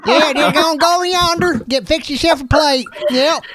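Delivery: high, rambling mock Southern yokel; Southern accent. Playing the character he hears in Josh, mock Southern accent. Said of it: "playing those characters too".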